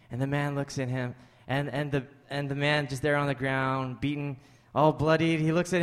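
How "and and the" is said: ordinary talk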